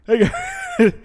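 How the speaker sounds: squeaky high mocking impression of the sister; high and laughing, teasing her. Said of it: "She's like".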